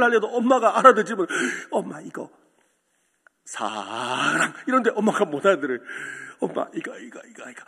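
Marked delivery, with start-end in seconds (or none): 1.7-4.5 put-on child murmurs the word, slow and soft; coaxing mother, drawn out softly; soft pleading. Little son coaxing his mute mother, soft and slow. 6.4-7.6 breathy whispered plea; hushed pleading. Retold or imitated. Boy whispering to his mother to understand.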